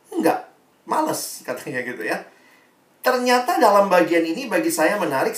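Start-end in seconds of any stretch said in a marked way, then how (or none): 0.1-1.4 high little mock refusal; much higher, reluctant voice; reluctant teen. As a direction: imitate a teen brushing off the question. Voicing teen's reluctant refusal.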